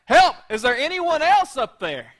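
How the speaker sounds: very loud, high comic yell; acted desperate holler as a punchline; yelling for help. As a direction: shout up for anyone else. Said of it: "And Jack starts yelling".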